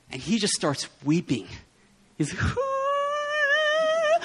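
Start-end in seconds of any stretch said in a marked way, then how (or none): 2.6-4.2 high sung-out mock cry; imitated high wailing cry. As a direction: wail it up high like a weeping friend. Friend starts weeping.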